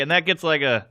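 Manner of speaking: ordinary talk, nothing marked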